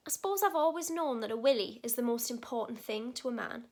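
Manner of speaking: ordinary talk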